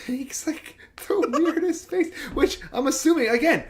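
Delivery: high, strained laughing mimicry; glue-huffing face voice. Podcaster imitating the glue-huffing face, strained and high. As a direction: squeeze it out like a weird high face. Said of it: "I'm trying to do my best impressions".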